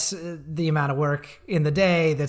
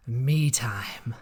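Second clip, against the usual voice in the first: whisper; 6 semitones lower, 4 dB quieter than usual. Real, not acted. Savouring a guilty pleasure.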